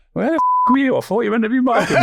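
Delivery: high, loud mocking jibe; cheeky taunt. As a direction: rub it in, teasing and gleeful. Mocking defender after scoring.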